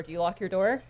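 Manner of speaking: ordinary talk, nothing marked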